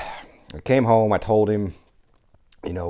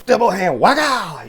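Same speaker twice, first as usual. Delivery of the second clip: excited burst; 7 dB louder, slower than usual. Real, not acted. Reliving the punch.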